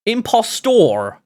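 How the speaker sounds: "Impostor" said slowly, ending "-tor" with a clear O R; spelling pronunciation. Shown as a demonstration. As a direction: spell it out by sound, drawing out "Impostor" with a clear O R at the end. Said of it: "O R".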